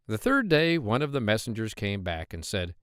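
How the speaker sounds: usual speaking voice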